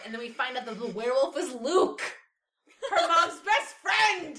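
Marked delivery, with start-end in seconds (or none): 2.9-4.4 high, loud, drawn-out with laughter; excited slow squeal of gossip; gleeful reveal. Real, not acted. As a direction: shout the juicy reveal, laughing, stretching it out. Gleeful big reveal while retelling.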